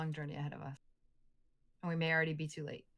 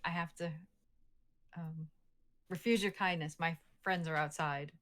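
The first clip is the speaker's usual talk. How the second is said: ordinary talk, nothing marked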